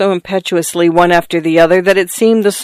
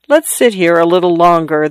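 plain reading voice

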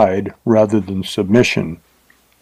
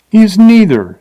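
much higher and louder, scornful retort; 10 semitones higher, 6 dB louder than usual. A narrator's reading. Woman answers Bill's question about her lover, scornful and loud. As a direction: fling it back at him with scorn. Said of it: "she replied scornfully".

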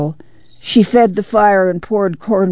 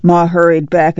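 ordinary delivery, nothing marked

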